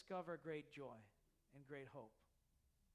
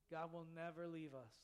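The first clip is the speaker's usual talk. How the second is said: ordinary talk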